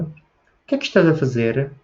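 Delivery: Portugal-style question intonation; European Portuguese accent. Shown as a demonstration. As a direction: imitate how the Portuguese ask it. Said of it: "tento imitar a pronúncia dos portugueses". (imitando o sotaque português europeu)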